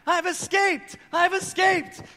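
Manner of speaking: put-on high, loud kid shouting I've escaped; child's gleeful yell. Dad imitating his son yelling across the playground, high gleeful shout. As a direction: shout it like a thrilled little boy on the run.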